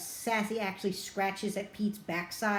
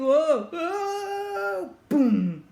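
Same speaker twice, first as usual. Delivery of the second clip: a high, loud hammed-up scream falling with a crash; mock screaming; 9 semitones higher, 8 dB louder, much slower than usual. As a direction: yell as the cats tumble down, then boom. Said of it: "they start screaming".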